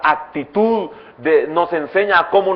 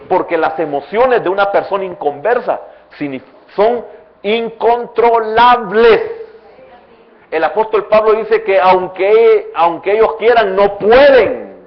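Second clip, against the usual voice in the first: louder, higher, slowing on incontrolables and no pueden; fervent preaching; 5 semitones higher, 6 dB louder than usual. Acted. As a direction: stretch the key words like a warning. Preacher rises to loud emphasis.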